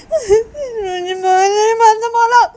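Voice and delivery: very high, loud, drawn-out wail; acted tantrum shriek; furious outburst. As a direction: screech in tearful protest. Lashes out before slapping.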